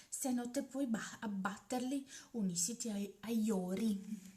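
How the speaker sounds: plain reading voice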